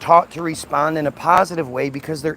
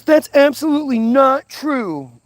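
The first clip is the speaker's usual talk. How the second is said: imitating an objector; 9 semitones higher, 3 dB louder than usual. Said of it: "Somebody says".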